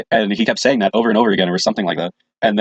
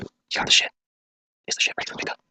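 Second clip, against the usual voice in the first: hushed, breathy whisper of a prank order; secretive whispered pickup request; prank call whisper; 8 dB quieter than usual. Retold or imitated. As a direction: whisper it like a shady deal.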